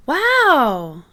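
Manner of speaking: loud delighted cry; amazed wow. Acted. Children seeing the flying pumpkin, with loud amazement. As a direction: marvel out loud. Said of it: "cry the children".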